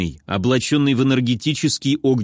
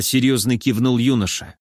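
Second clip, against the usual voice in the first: ordinary delivery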